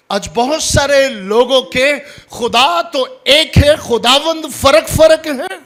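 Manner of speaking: very high, loud, strained-up proclamation; full-force preaching. Real, not acted. Preacher rebukes divided loyalties, loud and high. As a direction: cry it out to the congregation.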